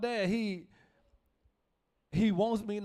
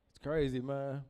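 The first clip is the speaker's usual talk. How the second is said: ordinary delivery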